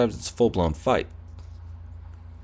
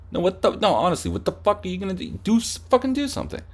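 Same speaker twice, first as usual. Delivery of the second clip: much higher, challenging yell; acted fight taunt; 9 semitones higher, faster than usual. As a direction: dare him to act.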